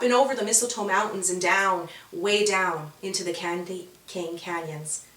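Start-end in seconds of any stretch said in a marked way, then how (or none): none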